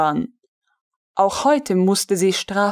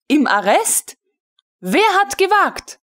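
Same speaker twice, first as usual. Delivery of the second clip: higher, indignant disbelief; who dared, in sharp indignation; outraged; 9 semitones higher than usual. A narrator's reading. Colonel hears of the arrest, indignant and higher.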